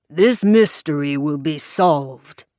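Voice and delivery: slow, booming fired-up preacher promise; Southern Baptist preacher. A narrator's reading. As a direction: preach it slowly like a revival sermon. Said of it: "best impersonation of a Southern Baptist preacher".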